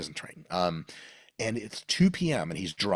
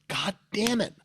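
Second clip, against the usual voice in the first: frustrated inner voice; 14 semitones higher, 4 dB louder, slower than usual. Retold or imitated. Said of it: "a part of me was like".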